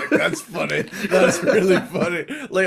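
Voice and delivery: ordinary talk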